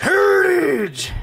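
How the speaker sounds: stretched, high, loud shout. Retold or imitated. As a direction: holler Heritage, slow and loud. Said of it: "he'll just be like".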